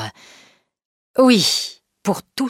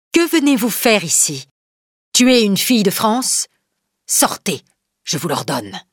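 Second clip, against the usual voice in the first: a firm, commanding voice, pitched higher; strong and calm; 4 semitones higher than usual. Acted. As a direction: face down the killers with authority. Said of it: "d'une voix forte et calme".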